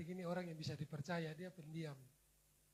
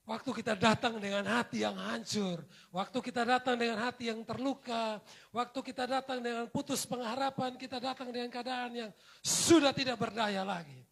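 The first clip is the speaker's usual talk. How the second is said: loud, rising sermon climax; full-force preaching; 4 semitones higher, 11 dB louder than usual. Acted. Pastor urges the congregation, loud fervent preaching. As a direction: preach it out to the hall.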